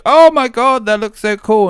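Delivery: high, loud gasp of delight at the trailer; amazed fan. Retold or imitated. Fans seeing the Demon Hunter trailer, shouting in high amazement. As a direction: blurt it out like a wowed kid. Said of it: "were like".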